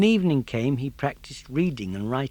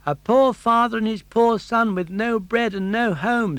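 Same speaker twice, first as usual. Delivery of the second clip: put-on high, louder pleading puppet boy; Pinocchio pleading; 10 semitones higher, 7 dB louder than usual. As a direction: beg at the door, pitched high. Pinocchio pleads at cottage door.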